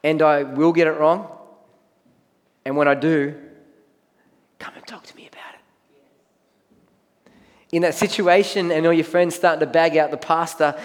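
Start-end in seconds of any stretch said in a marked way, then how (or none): 4.6-5.6 breathy stage whisper, no voice at all; a hushed confiding whisper. Real, not acted. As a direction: lean in and whisper it like a secret. Aside whispered to congregation.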